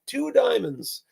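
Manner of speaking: high-pitched mock call-out from the audience; imitated yell. Audience member answers the magician's card request, high mock yell. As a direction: pitch it high as a pretend shout, without much volume. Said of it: "somebody yells out".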